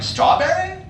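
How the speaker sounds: high-pitched questioning customer; mock customer asking, pitched high; clueless customer. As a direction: guess in a high, silly voice. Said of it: "It's like".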